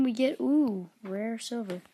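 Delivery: ordinary talk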